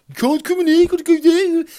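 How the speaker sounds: high, loud mock Birmingham accent; car park attendant turning him away, in a shrill-pitched mock Brummie accent. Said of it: "He was from Birmingham".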